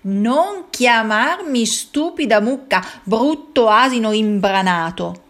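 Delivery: the insulted cow hitting back; offended cow. Acted. Cow snaps back at donkey. (mucca offesa che ribatte lenta e forte)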